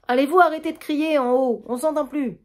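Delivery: crié d'en haut, plus aigu et plus fort; voisine qui crie. Acted. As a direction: lance ça d'un étage plus haut. (la voisine d'en haut crie pour faire taire la famille)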